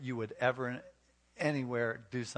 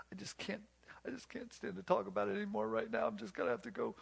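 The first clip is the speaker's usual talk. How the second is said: much higher, softer voice of a hurt wife; overwhelmed wife; 9 semitones higher, 4 dB quieter, faster than usual. Acted. Hurt wife breaking off the talk with her husband, overwhelmed. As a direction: act a wife giving up, high and small.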